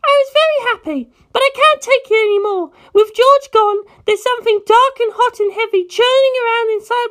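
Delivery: put-on a high, fierce girl set on revenge; Penelope's voice. Penelope's determined farewell.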